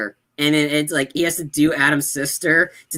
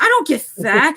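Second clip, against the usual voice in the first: very high, louder indignant voice; offended girlfriend; 11 semitones higher, 5 dB louder than usual. Retold or imitated. Girlfriend retorting to the insult, high indignant voice. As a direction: snap back, high and offended. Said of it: "She's like".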